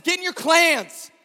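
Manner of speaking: high, loud battle cry of a sermon; preaching at full force. Acted. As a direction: rouse them like a war speech.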